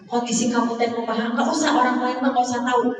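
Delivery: ordinary talk, nothing marked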